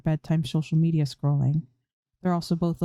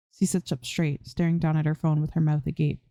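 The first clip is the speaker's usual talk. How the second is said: usual speaking voice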